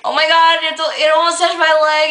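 high, loud panicked yelp; roommate freaking out. Retold or imitated. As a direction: squeal it like you just felt it brush your leg. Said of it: "he freaks out and he's just like".

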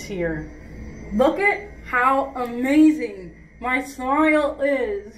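talking through a big grin; laughing while speaking; wearing snap-on veneers. Real, not acted. Seen 1: Bright uniform snap-on veneers visible in broad grin throughout; fitted earlier.

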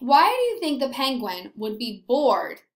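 ordinary delivery

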